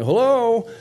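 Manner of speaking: loud, high call, falling at the end. Retold or imitated. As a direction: call out again to someone hard of hearing. Calling to a deaf father.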